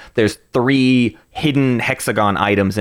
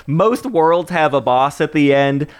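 ordinary talk, nothing marked

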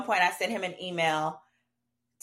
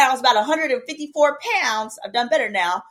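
ordinary delivery

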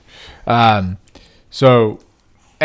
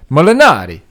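put-on Italian accent; 4 semitones higher, 5 dB louder than usual. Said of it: "the accents aren't working".

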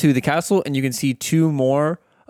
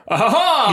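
high-pitched acted scream from below; high scream of someone in trouble; distant scream; 9 semitones higher than usual. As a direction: cry out "ah-ha-ha", much higher. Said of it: "a few screams down below".

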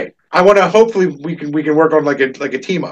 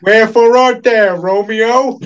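higher, slow, hammed-up Peter Griffin-ish recital; mock-grand stage reading in a cartoon voice; imitating a cartoon dad reciting Romeo and Juliet; cartoon Shakespeare recital; 7 semitones higher, 4 dB louder, slower than usual. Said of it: "they had him reciting Shakespeare".

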